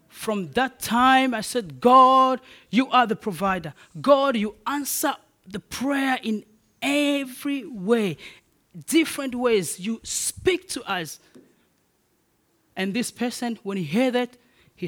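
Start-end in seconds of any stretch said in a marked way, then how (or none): 1.8-11.2 loud, ringing call to God; half-shouted praise prayer, higher; fervent prayer. Acted. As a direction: cry out your praise to God.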